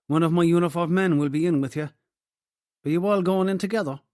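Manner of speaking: put-on Cork accent. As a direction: rattle off the news in broad Cork tones. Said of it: "in a strong Cork accent".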